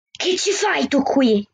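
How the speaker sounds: slow, restrained angry hiss. A narrator's reading. Policeman to the girl at his door, angry hiss. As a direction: hiss it slowly, with understated anger. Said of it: "le sibilò rabbioso".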